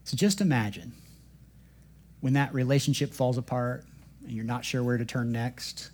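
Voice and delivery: usual speaking voice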